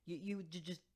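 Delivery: halting, tripping over each word; a shocked stammer, restarting each word. A narrator's reading. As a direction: stumble through it, breaking up the words. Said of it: "Jennabelle stammered".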